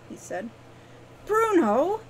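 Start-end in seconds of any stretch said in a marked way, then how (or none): 1.3-2.0 high, loud protest; frustrated exclamation. A narrator's reading. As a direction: cry out his name in exasperation. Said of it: "Wren exclaimed in frustration".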